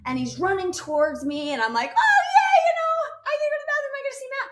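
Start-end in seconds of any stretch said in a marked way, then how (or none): 1.9-4.5 high, fast, giddy shouting; excited shout. Retold or imitated. Wife spotting her husband running toward her, excited shout. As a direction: squeal it out, thrilled to see him.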